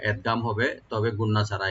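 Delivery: ordinary talk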